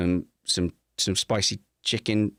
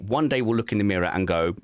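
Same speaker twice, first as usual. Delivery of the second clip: usual speaking voice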